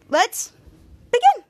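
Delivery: big jump up, loud and high on begin; excited kickoff. Real, not acted. Host kicking off the recap, loud and high. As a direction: launch the show like a host. Hyped start to episode recap.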